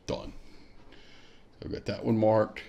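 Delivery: ordinary talk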